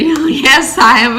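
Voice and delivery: laughing, high and loud happy yes; excited, laughing. Retold or imitated. New homeowner repeating her answer to the neighbor, laughing excitedly. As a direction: giggle through it, thrilled. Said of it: "And I was just so excited".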